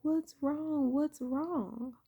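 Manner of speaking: put-on grandma pressing a crying child; worried grandma. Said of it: "she was like".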